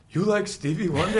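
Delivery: loud, high incredulous question; playful quoted outburst. Retold or imitated. Quoting punchline as siblings did.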